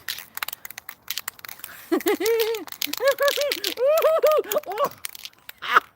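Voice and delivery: long high laugh; giddy laugh. Real, not acted. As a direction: cackle with glee.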